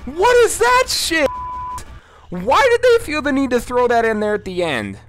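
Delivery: very high, loud scared yells; jolted panic after the catch; shrieking in fright. Real, not acted. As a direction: scream as he catches you. Caught by Freddy, jump scare.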